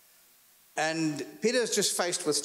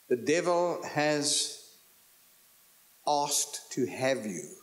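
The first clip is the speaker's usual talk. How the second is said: slow deliberate reading; much slower than usual. Shown as a demonstration. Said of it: "The Greek says".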